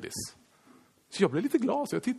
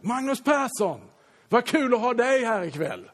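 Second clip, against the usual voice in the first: cheerful welcome; 9 semitones higher, 4 dB louder, slower than usual. Retold or imitated. Imagined warm public greeting.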